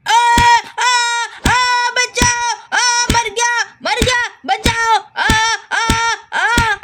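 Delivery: high yelps while being beaten; hammed-up cries of pain. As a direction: wail for rescue between short cries. कबाड़ी पिटते हुए मदद माँगता. (पिटते हुए दर्द भरी चीख-पुकार)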